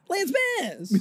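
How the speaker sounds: excited fan. Real, not acted. Admitting to friend she's a big fan, high squeal. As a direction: gush the name with a giddy squeal. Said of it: "Hard way to find out I'm a big fan".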